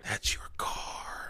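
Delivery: whispered, slow and hushed; a dazed whisper of realization; stunned whisper. Retold or imitated. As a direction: breathe it out in disbelief. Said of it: "And I was like".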